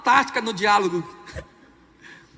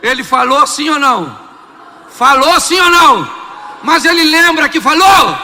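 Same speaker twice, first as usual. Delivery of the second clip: loud, higher challenge; shouted questions; 5 semitones higher, 10 dB louder than usual. Real, not acted. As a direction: fire the questions at them. (perguntas gritadas ao público)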